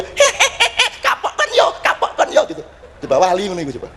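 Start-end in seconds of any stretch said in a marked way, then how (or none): 0.0-0.9 very high-pitched "hehehe"; mock cackle of a mad saint; squeaky mischievous giggle; imitated gleeful cackle. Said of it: "Terus dia". 1.0-2.4 very high squeaky taunt; mock gleeful crowing in falsetto; gloating holy fool. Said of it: "Terus dia".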